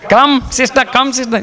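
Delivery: high-pitched pastor impression; put-on high voice, ushering everyone to sit; pastor addressing congregation. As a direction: call out high, imitating the pastor. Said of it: "he says to the congregation".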